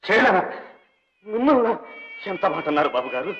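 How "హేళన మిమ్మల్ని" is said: shocked disbelief. Acted. Shocked at being called mocking.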